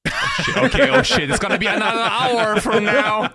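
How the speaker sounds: high, loud laughing oh shit; cackling at the thought of another hour; laughing groan. Real, not acted. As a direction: laugh out the mock dread of a long story. Mock dread at long story.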